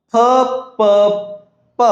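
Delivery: P said as "puh"; letter sound. Shown as a demonstration. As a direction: say P as "puh", not "pee". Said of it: "P sounds like P".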